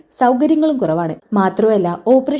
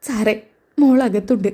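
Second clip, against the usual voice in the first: tearful; 2 semitones higher than usual. Acted. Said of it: "കനക കരഞ്ഞുകൊണ്ട് പറയുന്നുണ്ട്".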